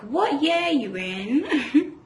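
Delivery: giggly high question, slowed; laughing. Retold or imitated. Flirty question imitated, laughing.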